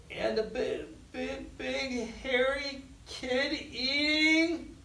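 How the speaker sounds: put-on a frightened boy losing his nerve; quavering, rising fear; shaky scared voice. A narrator's reading. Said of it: "Jared's voice shook too".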